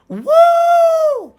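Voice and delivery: loud, very high whoop; excited woo. Pretend. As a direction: whoop at full volume. Celebrating money.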